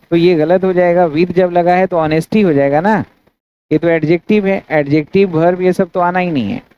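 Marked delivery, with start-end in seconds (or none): none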